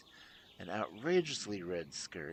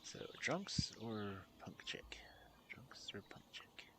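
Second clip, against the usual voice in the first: breathy secret aside to the listener; whisper; 8 dB quieter than usual. Acted. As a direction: lean in and whisper the choice.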